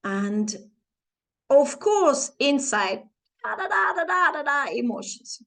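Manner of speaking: ordinary talk, nothing marked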